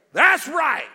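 loud, high shout; shouted pretend Jesus. Jesus stepping in to crush the king, shout.

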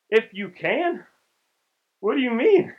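high, loud disbelief at "If you can?"; incredulous. Acted. Preacher voicing Jesus's shocked reply to the doubting father. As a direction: throw the question back in disbelief.

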